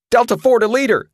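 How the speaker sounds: high, loud panicked radio call; panicky cry. A narrator's reading. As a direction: yell for help over the radio in panic. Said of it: "cried a panicky voice".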